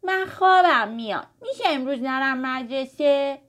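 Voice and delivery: put-on high, whiny sleepy child; sleepy boy. A narrator's reading. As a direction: plead drowsily to skip school. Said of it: "در حالی که چشاش بسته".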